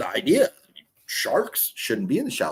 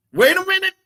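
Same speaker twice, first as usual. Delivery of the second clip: much higher, put-on squeaky repeat; hammed-up high-pitched double take; mock high voice; 6 dB louder than usual.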